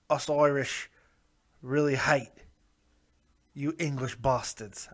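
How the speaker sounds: lightly done mock Irish accent, slowing at the end; a half-hearted stab at an Irish brogue; bad Irish accent. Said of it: "not a good English or a good Irish accent".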